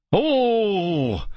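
high, sudden falling oh; startled cry. A narrator's reading. Boy as the wall gives way, startled cry. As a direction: gasp out in shock. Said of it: "I let out a startled cry".

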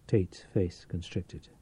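ordinary delivery, nothing marked

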